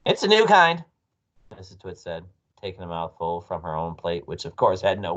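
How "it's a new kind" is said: high, loud wifely voice; breezy assurance. A narrator's reading. Wife covering up worm trick, high and loud. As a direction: pitch it up and brush off his worry.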